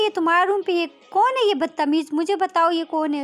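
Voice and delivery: high, loud indignant demands; outraged father. Acted. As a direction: demand answers as an angry parent. Outraged father demands who insulted daughter.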